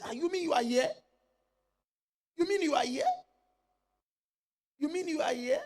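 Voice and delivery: high, loud, incredulous cries; astonished. Retold or imitated. Churchgoers to a member, astonished to see them there. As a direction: gasp it out as if they can't believe you came. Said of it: "look at you and see you and say".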